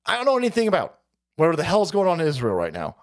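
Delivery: ordinary delivery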